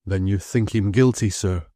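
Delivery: ordinary delivery, nothing marked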